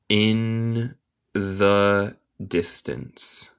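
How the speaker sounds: "the puppy's bark" very slowly, word by word; long pauses between each word; slow dictation. Shown as a demonstration. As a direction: isolate each word in a slow teaching read. Teacher models slowly, then reads connected.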